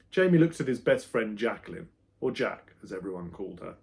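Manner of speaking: plain reading voice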